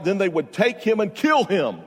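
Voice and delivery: ordinary talk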